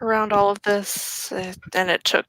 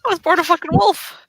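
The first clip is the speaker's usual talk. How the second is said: higher, fast, offended snap; indignant retort; 8 semitones higher, 3 dB louder, faster than usual. Acted. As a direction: scoff at being called an elder. Werewolf rejects elder title indignantly.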